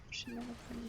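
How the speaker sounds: whispered aside. Real, not acted. Player complaining about bad conditions, whispered aside. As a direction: drop to a whisper mid-sentence.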